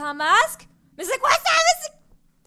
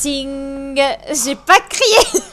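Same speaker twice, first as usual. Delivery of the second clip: very slow, drawn-out protest; defensive denial; 7 semitones lower, much slower than usual. Real, not acted. Gamer denying she screamed, slow and defensive. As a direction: insist you didn't scream. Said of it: "Meuf qui fait genre".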